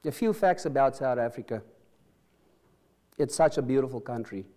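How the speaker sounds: ordinary delivery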